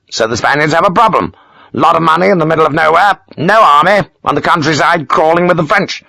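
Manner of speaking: proud of his clever solution; self-satisfied Major. Acted. Major explaining the gold's story to Sharp, pleased.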